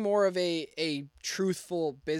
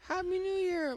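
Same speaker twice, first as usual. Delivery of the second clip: new year countdown crowd; 14 semitones higher than usual. Real, not acted.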